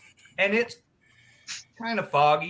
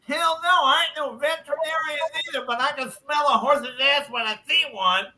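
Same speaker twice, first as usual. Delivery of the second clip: a hint of a higher, faster smart-aleck voice; cocky retort; 8 semitones higher than usual. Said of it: "He goes".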